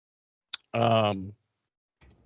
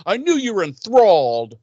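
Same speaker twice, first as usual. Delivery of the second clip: higher, mock-wounded accusation; butthurt; 6 semitones higher than usual. Host voicing Riley accusing Buffy, butthurt. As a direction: act the hurt boyfriend, exaggerated. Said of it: "Riley gets all butthurt".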